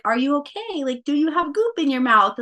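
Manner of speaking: high-pitched mama checking on her newborn; anxious mama. Retold or imitated. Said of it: "the mama in me".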